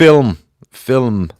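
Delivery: "Film, film," said the Dublin way with a held m; Dublin accent. Shown as a demonstration. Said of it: "It's film, right?".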